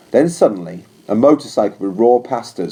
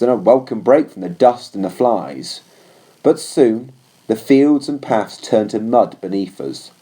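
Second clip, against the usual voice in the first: ordinary delivery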